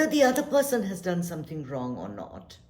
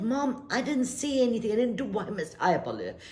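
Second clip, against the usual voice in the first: put-on protesting child; 6 semitones higher, 4 dB louder than usual. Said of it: "So I said".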